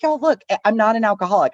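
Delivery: eager announcement. Retold or imitated. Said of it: "I look at everybody and I'm like".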